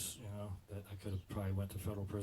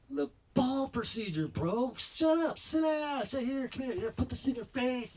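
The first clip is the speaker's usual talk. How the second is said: much higher, loud barked orders; yelled bro commands; bossy shouting surgeon; 9 dB louder, slower than usual. Retold or imitated. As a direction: shout him down and boss him around. Surgeon barking orders at him.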